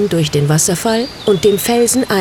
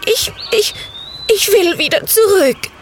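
much higher, stammering horrified witch; horrified cry; 13 semitones higher than usual. A narrator's reading. Little witch cries out in horror at the city, high stammer. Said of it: "rief sie ganz entsetzt".

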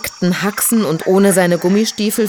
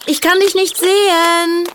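calling out; 12 semitones higher than usual. Acted. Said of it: "rief lautstark".

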